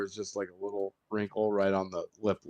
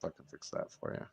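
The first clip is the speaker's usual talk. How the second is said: quiet aside; 5 semitones lower, 11 dB quieter than usual. Retold or imitated. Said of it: "I'm like".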